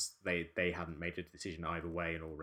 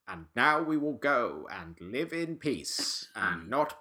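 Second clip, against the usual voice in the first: slow, higher, stilted proclamation; awkward Caesar speech; 6 semitones higher, 7 dB louder, slower than usual. Acted. Caesar addressing the rebel apes at the climax, awkward proclamation. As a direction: declaim it slowly and awkwardly, louder and higher. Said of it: "very awkwardly just says".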